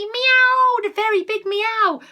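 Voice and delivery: high, loud and slow, like a sudden meow; big loud meow. A narrator's reading. Reading a picture book to a child, loud high meow. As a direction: belt it out like the cat.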